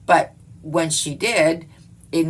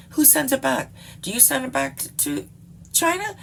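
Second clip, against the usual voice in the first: incredulous questioning; 9 semitones higher than usual. Real, not acted. Rhetorical rant about returning goods.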